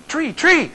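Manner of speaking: insistent high "tree, tree", t in place of th; "tree" with a hard t, high and falling; Belizean th as t. Retold or imitated. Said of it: "They can't pronounce the, the th".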